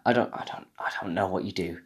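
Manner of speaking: ordinary talk, nothing marked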